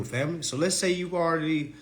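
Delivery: normal talking voice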